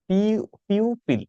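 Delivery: slow, careful পিউপিল split as পিউ then পিউপিল; pronouncing pupil. Shown as a demonstration. Teacher modelling the word pupil for students, slow and careful. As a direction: spell out the sound পিউপিল syllable by syllable. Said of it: "এই যে উচ্চারণটা হবে পিউপিল".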